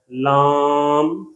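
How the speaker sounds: لام drawn out with a nasal aa; nasalized vowel. Shown as a demonstration. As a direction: stretch لام through the nose. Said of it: "اس طرح غنے پیدا ہو رہے ہیں".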